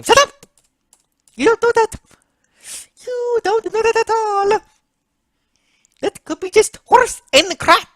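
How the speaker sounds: high, loud squawking outburst; angry Mario. Acted. Mario cutting off Luigi's happy news, angry. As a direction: shout him down in a high voice.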